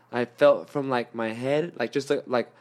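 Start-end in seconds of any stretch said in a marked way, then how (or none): none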